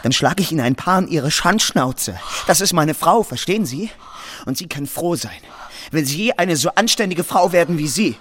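furious. Acted. Clerk defends his wife to Marie, angry outburst. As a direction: threaten her, rising fury.

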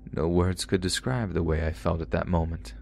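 ordinary delivery

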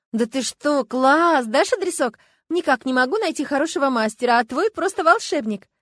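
loud, high-pitched enthusiasm; gushing admiration. A narrator's reading. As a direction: rave about your friend's makeover. Friend gushing over new look. (восторженно, громко и высоко)

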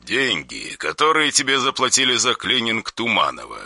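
soft, cold menace; quiet threatening caller on the phone; dry harsh voice. Acted. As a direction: threaten without raising the voice.

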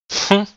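loud high scornful hmph; mocking snort. A narrator's reading. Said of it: "насмешливо фыркнула Юджин".

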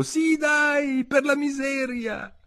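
very high drawn-out chorus cry; group echo. A narrator's reading. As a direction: call out like a squeaky crowd joining in. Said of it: "e tutti le fecero eco".

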